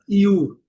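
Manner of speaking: "iu" como um i deslizando para u. Shown as a demonstration. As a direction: iu longo, bem separado. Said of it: "o som original dela". ("iu" pronunciado como i-u)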